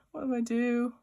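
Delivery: slightly high, helpless fretting; mock freaked out. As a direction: fret mildly, as a worried unicorn. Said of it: "he's so freaked out".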